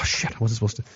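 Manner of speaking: ordinary talk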